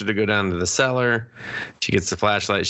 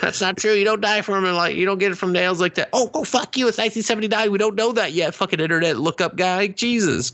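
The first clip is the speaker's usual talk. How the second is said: high mocking put-on voice, much higher; mock whiny character; 10 semitones higher than usual. Mocking a movie character who calls out a know-it-all. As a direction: sneer it in a squeaky high voice.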